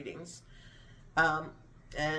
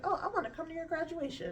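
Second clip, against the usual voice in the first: high, soft, quick put-on voice; mimicked godmother; 11 semitones higher, 5 dB quieter, faster than usual. Godmom popping up out of the blue, high mimicking voice. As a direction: mimic someone sweetly inviting herself along.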